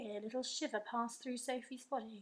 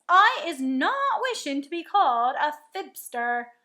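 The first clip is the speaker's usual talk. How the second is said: a sharp, much louder giant's retort; sharply; 4 semitones higher, 13 dB louder than usual. A narrator's reading. The giant, offended by Sophie's doubt, speaks sharply. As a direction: snap it out, offended. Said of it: "said the B F G sharply".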